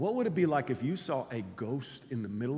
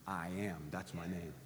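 much lower and softer, hushed and grave; hushed, reverent divine voice; solemn quiet; 11 semitones lower, 14 dB quieter than usual. Acted. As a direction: speak as God naming himself. God voiced answering Moses.

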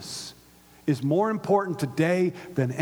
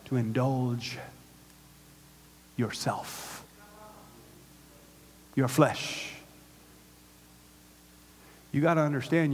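usual speaking voice

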